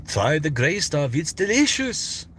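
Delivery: slow French accent, climbing high on delicious; sing-song French host, stretched out; Lumiere. Shown as a demonstration. Streamer trying a Beauty and the Beast character, slow high French accent. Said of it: "can I do Lumiere from".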